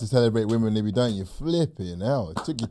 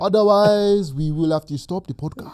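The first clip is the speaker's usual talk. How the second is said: put-on Nigerian accent; 6 semitones higher, 4 dB louder than usual. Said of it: "in a Nigerian accent".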